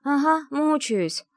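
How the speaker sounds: slow, high groaning reply. Acted. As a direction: moan it out while hanging. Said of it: "простонала я".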